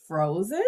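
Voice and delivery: "Frozen?" with a rising end; lightly lifted, inquiring "Frozen?"; questioning echo. Shown as a demonstration. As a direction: repeat "Frozen" back as a gentle question. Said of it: "like it's a question".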